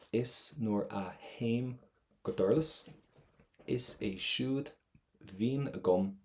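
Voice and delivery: slow, phrase by phrase, "haim" without a T; measured Irish line, clear V on "Vín", pauses between phrases; model line reading. Shown as a demonstration. Teacher reads the line again for students, careful pronunciation of "haim" and "Vín". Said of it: "let's go for that line again".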